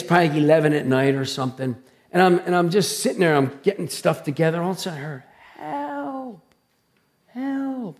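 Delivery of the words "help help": high, soft cry for help; imitated distant scream. Preacher imitating screams from the dark bay, high faint cry. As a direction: call out thinly high and quiet, as if from far off. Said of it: "I just heard this screaming".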